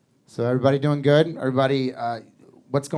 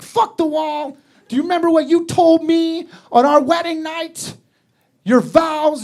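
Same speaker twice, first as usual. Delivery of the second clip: angry wife; 11 semitones higher, 4 dB louder than usual. Retold or imitated. Angry wife, high and loud.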